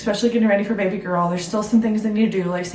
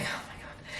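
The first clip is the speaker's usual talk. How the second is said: whisper; 20 dB quieter than usual. Retold or imitated. Realizing she has no car.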